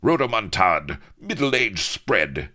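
captain sneering at the pirate's waistline; snapping retort. A narrator's reading. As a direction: spit the insult back. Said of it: "snapped Avery".

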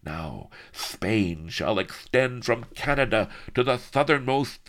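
grand conqueror's tirade, climbing high and loud; ranting Spanish villain. A narrator's reading. Villain vows conquest of the Antilles, bombastic rant. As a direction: stretch out the threats bombastically.

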